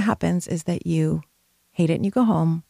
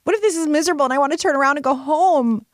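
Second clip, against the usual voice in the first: terrified inner voice; panicky worry; 8 semitones higher than usual. Retold or imitated. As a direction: rattle off fears in a higher panic. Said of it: "a part of me was terrified".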